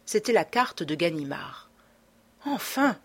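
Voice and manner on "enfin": a sudden high cry of relief; relieved exclamation. A narrator's reading. Beautrelet crying out in relief at Ganimard's letter, excited. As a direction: burst out with relief at last. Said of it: "s'écria Beautrelet".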